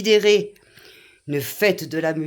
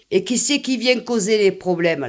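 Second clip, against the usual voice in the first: usual speaking voice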